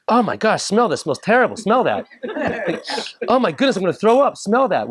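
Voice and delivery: high, laughing disgusted squeals; hammed-up gross-out; eager to share a bad smell; mock disgust. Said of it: "You ever do this to somebody?".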